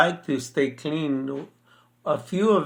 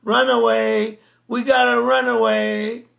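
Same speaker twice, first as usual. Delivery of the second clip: high, raised mock-alarm call to flee; retold yell; 9 semitones higher, 4 dB louder than usual. Husband yells to his wife as the dogs get out, mock-alarmed yell. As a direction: call it out high, playing up the panic. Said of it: "I yell to my wife".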